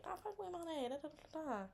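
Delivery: high gushing recap. Retold or imitated. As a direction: rattle it off up high. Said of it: "you like".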